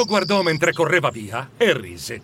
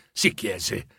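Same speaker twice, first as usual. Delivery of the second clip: plain reading voice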